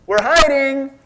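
put-on high, loud sing-song kid voice; childish hide-and-seek call; childlike answer. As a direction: say "We're hiding." like a naive child. Adam and Eve mimicked like kids.

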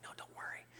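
hushed church whisper; very soft, unvoiced whisper; whispered reassurance. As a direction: whisper it to a pew neighbour. Lady whispers during sermon.

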